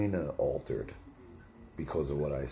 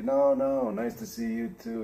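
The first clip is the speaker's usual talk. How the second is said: high celebrity voice, easing down; hammed-up polite protest, starting high; Howard Stern reply; 3 dB louder than usual. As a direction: imitate a star brushing off an apology. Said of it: "and he went".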